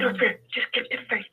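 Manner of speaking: voice going in and out. Real, not acted. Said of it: "you have to excuse my voice".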